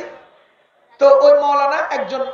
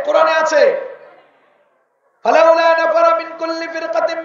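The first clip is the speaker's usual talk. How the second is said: full-throated sermon cadence, hammering each phrase; rousing the congregation from the pulpit; fiery preaching; 2 semitones higher than usual. Acted. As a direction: call it out to the crowd like a preacher. Preacher at full force, calls out.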